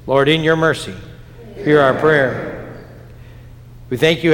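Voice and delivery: ordinary talk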